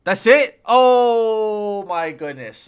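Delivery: loud, high relieved exclamation; excited. Real, not acted. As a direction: burst out in happy disbelief. Relieved at getting it right.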